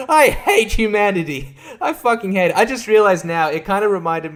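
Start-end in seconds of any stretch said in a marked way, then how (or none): 0.1-2.6 high-pitched comic loathing; mock disgust. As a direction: declare your hatred high and exasperated. Acted reaction to the cute robot.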